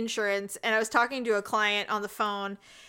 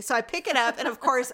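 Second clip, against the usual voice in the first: usual speaking voice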